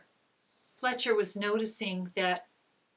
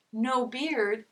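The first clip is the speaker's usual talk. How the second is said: ordinary delivery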